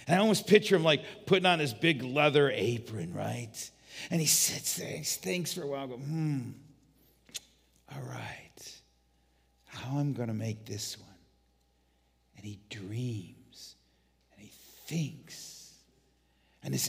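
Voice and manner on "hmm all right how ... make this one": hushed pondering. Acted. Jesus pondering in his workshop.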